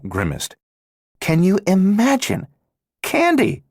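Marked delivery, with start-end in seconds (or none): 1.2-3.6 scornful disbelief. Acted. Scornful mockery of the candy.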